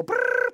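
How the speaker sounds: loud, high trilled ring; imitated phone ring. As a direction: trill like a ringing telephone. Imitates a telephone ringing.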